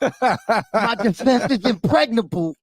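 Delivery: slow, lisping boxer impression; Mike Tyson. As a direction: draw it out in a boxer's lisp. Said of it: "Tyson goes like this".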